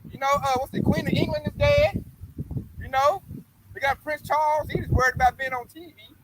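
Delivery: ordinary talk, nothing marked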